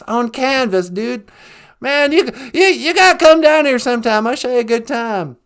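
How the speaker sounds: loud folksy Texan Bush; hammed-up Bush voice; George W. Bush impression. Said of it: "George W. Bush".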